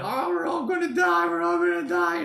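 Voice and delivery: high mock-panicked wail, then calming down. Mocking lockdown panic to viewers, shrill mock wail. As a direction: ham up a doomsayer shrieking. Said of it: "we're not anymore in that".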